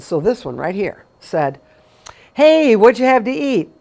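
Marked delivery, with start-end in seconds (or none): 2.3-3.7 sudden loud high call across the room; blurted loud question. Retold or imitated. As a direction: blurt it out loudly, caught off guard. Friend blurts question at restaurant.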